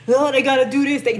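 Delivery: ordinary talk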